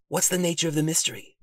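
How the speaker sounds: plain reading voice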